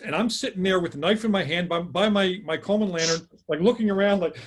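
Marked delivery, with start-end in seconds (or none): none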